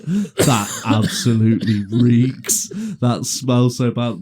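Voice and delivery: loud, drawn-out imitation of mum; mock disgust. Mum smelling her son's accident, loud mock disgust. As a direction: stretch it out loudly in hammed-up disgust.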